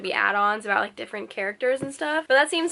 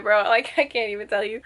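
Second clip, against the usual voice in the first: ordinary talk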